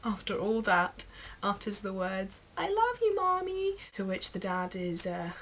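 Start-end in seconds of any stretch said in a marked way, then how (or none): 2.6-3.8 put-on very high American toddler voice; little girl with American accent. American little girl's message to her mum, high mock child voice. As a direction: imitate an American little girl, pitched right up. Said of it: "The little girl then".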